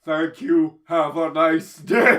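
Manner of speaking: loud, slow chirpy robot farewell; cheery robot voice. Retold or imitated. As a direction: announce it like a polite machine. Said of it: "the robot goes".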